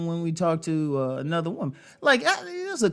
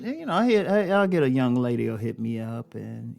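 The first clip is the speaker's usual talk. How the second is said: ordinary delivery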